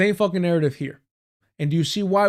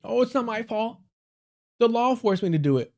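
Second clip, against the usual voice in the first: higher, whiny mock excuse; mocking whine; 7 semitones higher than usual. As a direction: squeak out the lame excuse in parody. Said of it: "He was forced to do this by law".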